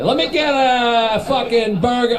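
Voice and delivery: slow, high, exaggerated Boston accent. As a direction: stretch it out in a hammed-up Boston accent. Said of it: "I'm doing a Boston accent".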